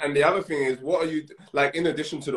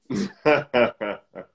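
laughter; 2 semitones lower, 4 dB louder than usual. Real, not acted. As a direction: burst of real laughter. Laughs at the hard question.

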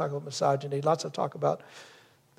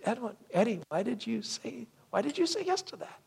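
mildly imitating a frustrated, higher-pitched wife; a touch of pressed exasperation, pitched up; upset, frustrated wife; 6 semitones higher, 5 dB quieter than usual. Wife questioning husband's yes, mildly imitated upset tone. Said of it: "upset in the tone".

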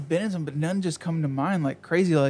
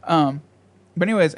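ordinary talk, nothing marked

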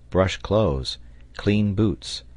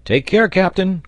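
high, loud call of caution; warning cry; 12 semitones higher, 6 dB louder than usual. A narrator's reading. Sailor warning the captain at the ladder, crying out. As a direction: cry out to warn him. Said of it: "cried Pencroft".

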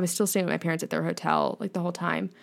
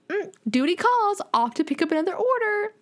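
cute robot voice; 10 semitones higher than usual. Retold or imitated. Vlogger imitating the hotel robot leaving after a delivery, high and cute. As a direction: chirp it like a busy little delivery robot.

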